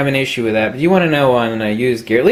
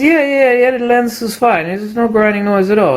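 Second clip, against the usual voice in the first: old salesman impression; 9 semitones higher than usual. Old clerk back on the phone with the lens, lightly voiced. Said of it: "He says".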